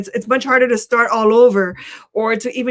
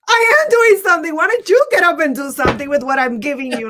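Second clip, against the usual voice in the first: higher-pitched, indignant God, hitting the first words hard; exasperated God; 4 semitones higher than usual. Acted. God answering people who beg him for help, exasperated. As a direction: protest like a fed-up God, starting high then easing. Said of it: "God is up there shaking his head going".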